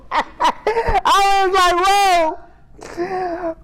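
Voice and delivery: high, slow whoa through laughter; laughing whoa. Retold or imitated.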